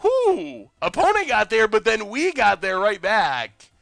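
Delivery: real, thrilled game-caller excitement; excited. Real, not acted. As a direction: whoop and crow over a last-second win. Celebrates a last-moment game win.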